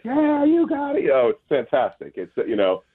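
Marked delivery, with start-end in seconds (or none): 0.0-1.1 high, slow, sing-song mock taunt; mock taunting neighbor. Neighbor taunting sign thieves on camera, high mock sing-song. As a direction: tease them like you caught them red-handed. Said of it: "he's like".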